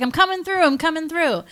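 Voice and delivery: high-pitched hero calling out; calling movie hero. Retold or imitated. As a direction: announce it to a packed crowd, high. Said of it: "So Mick's like".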